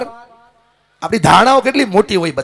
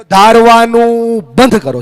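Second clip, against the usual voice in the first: higher-pitched, drawn-out rallying call; loud call-out; 5 semitones higher, 8 dB louder, slower than usual. Shown as a demonstration. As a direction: lead the crowd in a slow, loud chant. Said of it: "જોરથી બોલો". (જોરથી, લંબાવીને બોલાવેલો નારો)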